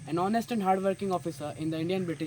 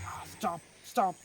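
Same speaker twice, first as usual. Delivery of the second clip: half-hearted shout, no real volume; a restrained cry to halt, falling sharply; shouted order; 2 semitones higher, slower than usual. A narrator's reading.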